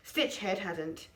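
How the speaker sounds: usual speaking voice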